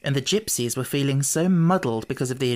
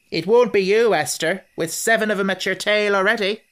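raised voice, arguing; loud quarrel; 6 semitones higher, 5 dB louder than usual. A narrator's reading. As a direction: shout them down in a quarrel.

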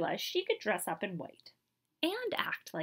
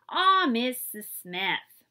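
whiny protest; 3 semitones higher, 8 dB louder, much slower than usual. Acted. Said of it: "Althea muttered".

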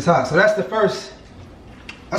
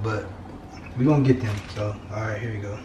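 ordinary talk

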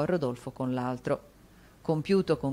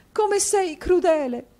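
even higher, louder, slow wailing plea; rising sob; 8 dB louder, slower than usual. A narrator's reading. Wife to husband, wailing louder. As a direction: break into a hammed-up wail.

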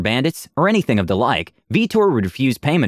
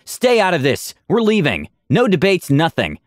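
raised order; 6 semitones higher than usual. Acted. Aura ordering the crowd.

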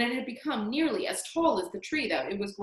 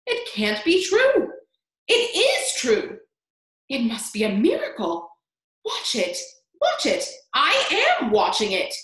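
amazed yelling; 3 semitones higher, 6 dB louder than usual. A narrator's reading. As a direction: yell in disbelief, stretching the words. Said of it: "Aunt Spiker yelled".

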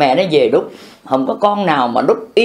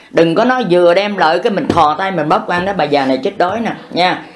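ordinary talk

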